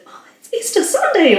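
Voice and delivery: high, delighted exclamation; joyful wonder. Retold or imitated. Woman wakes her husband at first contraction, high with joy. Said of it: "I said".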